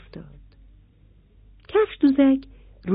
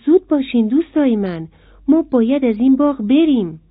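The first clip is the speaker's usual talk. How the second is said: in a hurry; 5 semitones higher, 7 dB louder than usual. A narrator's reading. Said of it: "با عجله گفت".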